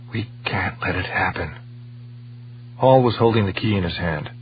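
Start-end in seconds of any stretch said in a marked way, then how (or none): 0.1-1.5 hushed, tense whisper. A narrator's reading. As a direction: breathe it out, barely voiced. Stone, trapped, dreading the bomb.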